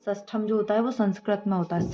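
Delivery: ordinary talk, nothing marked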